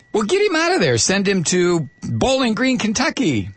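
high-pitched, hammed-up hasty order; mock church official. Mock church official moving priest.

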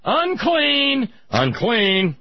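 high, loud leper's warning cry; mock screaming. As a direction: cry it out to clear the street. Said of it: "you had to wander around screaming".

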